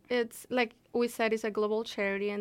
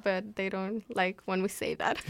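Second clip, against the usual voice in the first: ordinary talk, nothing marked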